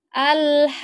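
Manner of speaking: Al-haqqah with long vowel then hard qaf; over enunciating. Shown as a demonstration. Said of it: "Qafnya ini ditekan atau dihentak".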